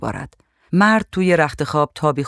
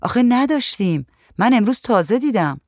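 higher-pitched, bewildered explaining; dazed; 7 semitones higher than usual. A narrator's reading. Woman puzzled by sudden roaches to painter, mildly dazed. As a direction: protest with a touch of bafflement. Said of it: "منگ گفت".

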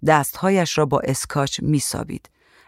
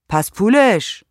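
short higher-pitched narrated yell; shout; 6 semitones higher, 4 dB louder, slower than usual. A narrator's reading. Man calls after the departing painter, shouting. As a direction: call after someone, voice raised. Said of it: "مرد داد زد".

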